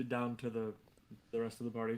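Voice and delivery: usual speaking voice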